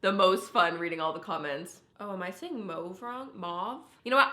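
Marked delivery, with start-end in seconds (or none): 2.7-3.0 mauve with a long O, like "mohv"; own usual pronunciation. Shown as a demonstration. Vlogger doubting her own word, mauve said "mohv". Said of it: "I would always say mauve". 3.3-3.8 mauve with an open "ah", like "mahv"; questioning "mahv" for mauve, rising; American pronunciation. Shown as a demonstration. Vlogger trying out the correction, mauve said "mahv". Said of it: "that's the American way".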